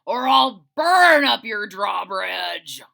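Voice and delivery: booming slow dragon threat; loud roar. Acted. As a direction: roar at the villagers to stop. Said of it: "and roared loudly".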